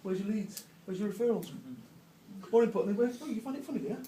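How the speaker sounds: a hint of a menacing boss; quiet, menacing interrogation; lightly voiced bullying chairman; hard-right networker. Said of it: "the hard right".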